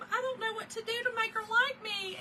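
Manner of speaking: high, tearful, pleading wail; retold crying. Girlfriend crying to her boyfriend after his mother snubbed her, tearful. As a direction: voice breaking as she retells crying. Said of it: "I just immediately start crying".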